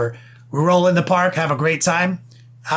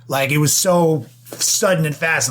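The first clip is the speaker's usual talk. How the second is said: normal talking voice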